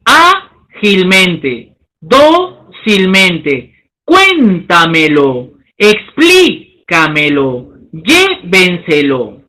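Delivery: each word drawn out slowly to show the stress; slow syllable-by-syllable. Shown as a demonstration. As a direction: spell out the syllables slowly like a teacher. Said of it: "Por ejemplo".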